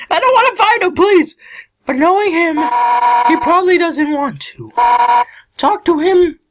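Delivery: high-pitched tough fighter voice; high character voice. Acted. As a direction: voice a brash friendly fighter up high.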